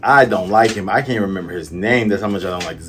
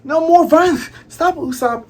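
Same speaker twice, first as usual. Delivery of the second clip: much higher, louder pleading cry to stop a fight; high pleading girl's cry; 5 dB louder than usual. Acted. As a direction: plead high and loud like a frightened girl. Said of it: "And then Kaya yells".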